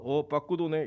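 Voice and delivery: acted indifference, low and soft; low, understated noticing; unexcited reaction. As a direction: play someone totally unimpressed by a game throw. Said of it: "이런 사람 없어요".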